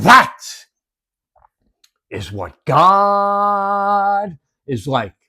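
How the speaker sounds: very slow, lifted, with awe; drawn-out climax. Real, not acted. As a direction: stretch every word of the line.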